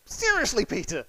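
high, disbelieving "Seriously"; fed-up teacher catching him out; Jesus exasperated at sleeping Peter; exasperated rebuke. Retold or imitated. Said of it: "comes back and goes".

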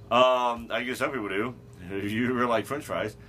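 ordinary talk, nothing marked

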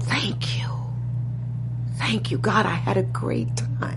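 soft, half-whispered thanks; light, grateful voice. Retold or imitated. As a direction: breathe it out gently, like a last farewell. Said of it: "It was with, you know, lightness".

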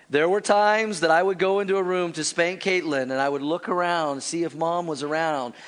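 ordinary delivery

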